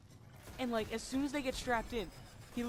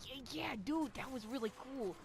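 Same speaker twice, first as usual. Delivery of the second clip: imitated friend's voice; 3 semitones higher, 4 dB quieter than usual. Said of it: "his voice is".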